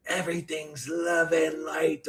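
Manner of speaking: slow, quiet put-on guru voice; mock guru role. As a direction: lightly imitate a fake spiritual teacher. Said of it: "put on a role".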